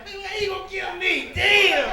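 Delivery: high, wailing comic protest; hammed-up tearful plea for your life; mock crying. Captured man pleading with those about to kill him, mock crying. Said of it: "brothers start crying".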